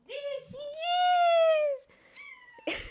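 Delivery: very high, loud, long-drawn squeal; squealed greeting. Real, not acted. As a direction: shriek it slowly in delight at your pet.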